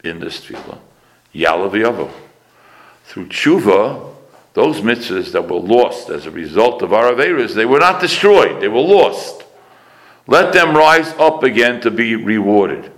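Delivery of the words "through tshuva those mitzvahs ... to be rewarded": loud, building declamation with high peaks; impassioned preaching. Real, not acted. Preacher on lost mitzvahs rising again, impassioned. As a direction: preach it out, punching the key words.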